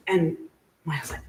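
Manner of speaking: normal talking voice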